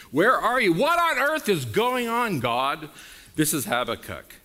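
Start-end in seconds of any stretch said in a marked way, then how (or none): 0.1-2.9 high, loud cry to God; anguished shout. Retold or imitated. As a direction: shout up at heaven. Voicing a desperate believer.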